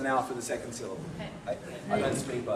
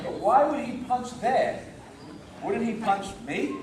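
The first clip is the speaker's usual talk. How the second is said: slow, higher-pitched puzzled questioning; puzzled objection; 6 semitones higher than usual. Retold or imitated. Teacher voicing a baffled demonstration partner, puzzled objection. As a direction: question it slowly with rising disbelief. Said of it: "the other person will show".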